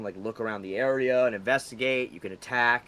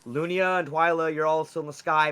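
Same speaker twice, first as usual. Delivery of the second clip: normal talking voice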